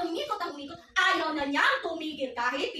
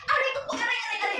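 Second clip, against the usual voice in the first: high-pitched mock ouches; string of high demonstrated pain cries; crying out in pain; 7 semitones higher, much faster than usual. Shown as a demonstration. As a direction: act out being hurt, voice lifted high. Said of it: "Yes, masakit".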